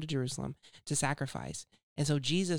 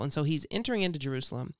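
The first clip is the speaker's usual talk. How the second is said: ordinary delivery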